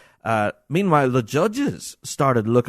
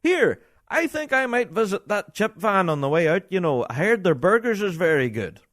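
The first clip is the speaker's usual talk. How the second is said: a lightly voiced chatty Scottish punter, pitched up; mock chatty spectator; 8 semitones higher than usual. A fan chatting through the band in a mild mock punter voice.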